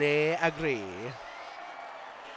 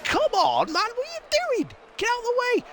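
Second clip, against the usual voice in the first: exasperated racer, pitched high; frustrated driver. Retold or imitated.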